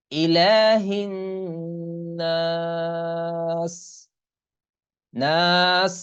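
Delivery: slow chant with each নাস held very long; Quran recitation. Shown as a demonstration. Teacher shows students how Surah Nas is chanted, long drawn tilawat. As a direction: stretch every নাস out slowly. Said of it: "এরকম করে".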